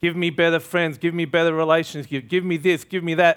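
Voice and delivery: greedy demanding prayer. Retold or imitated. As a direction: rattle off demands to a spiritual Santa Claus. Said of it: "like a spiritual Santa Claus".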